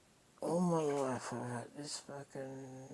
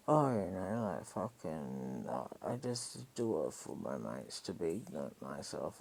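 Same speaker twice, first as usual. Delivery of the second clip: much lower, dull mock voice of him; imitating him; 8 semitones lower, 3 dB louder than usual. Mocking his excuse about selling for his mates, voiced low. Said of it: "he goes".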